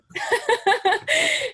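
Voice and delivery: high bright burst of laughter; quick high laugh, then on. Real, not acted. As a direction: laugh at your younger self. Self-deprecating laugh after naive.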